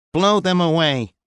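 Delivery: high, slow machine translator voice; flat alien words through a translator box. A narrator's reading. Louis's translator talking to the squad, high and slow.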